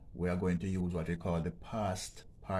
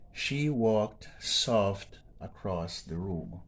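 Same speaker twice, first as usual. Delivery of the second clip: slow read-out of the sentence; 4 dB louder, much slower than usual. Shown as a demonstration. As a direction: read the example sentence slowly and clearly. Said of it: "let's move on to number seven".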